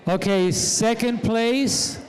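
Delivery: drawn-out announcement; slow suspense. Real, not acted. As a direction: build suspense slowly.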